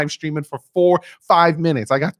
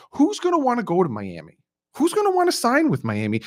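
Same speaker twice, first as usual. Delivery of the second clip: higher sneering doubter voice; mocking doubters; 10 semitones higher than usual. Imitating people who doubted Miami, higher sneer. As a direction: scoff like a skeptic. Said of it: "A lot of people wanted to say".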